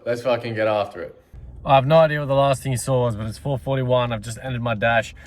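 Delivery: usual speaking voice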